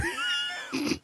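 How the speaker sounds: shrieking laugh. Real, not acted. Laugh after shout.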